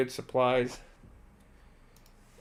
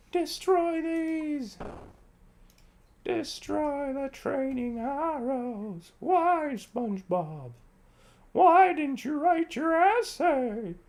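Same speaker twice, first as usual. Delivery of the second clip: very high, drawn-out cartoon cry; hammed-up cartoon wailing; 14 semitones higher, much slower than usual. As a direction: wail it slowly, high-pitched, mock anguish. Mock wailing at items, SpongeBob.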